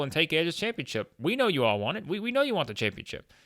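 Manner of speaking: imitating a wired partner who won't let it go; hopped up, fired up. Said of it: "he's hopped up, man".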